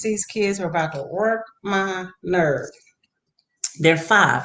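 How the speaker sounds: ordinary talk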